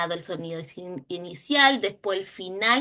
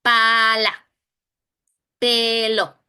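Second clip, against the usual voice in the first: Papa, Pala, Pelo called out louder; loud classroom word reading; reading words aloud; 6 dB louder, much slower than usual. Shown as a demonstration. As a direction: announce each word like a reading card. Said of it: "¿Qué dice junto?".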